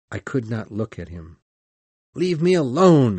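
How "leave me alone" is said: high, loud outcry; screamed. A narrator's reading. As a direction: cry it out to drive him off. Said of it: "I screamed".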